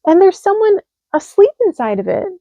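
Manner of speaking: high delighted discovery; thrilled surprise; joyful cry. A narrator's reading. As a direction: hedgehog finds his hat. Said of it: "he cried".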